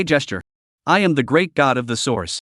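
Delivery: ordinary delivery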